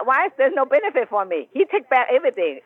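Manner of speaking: normal talking voice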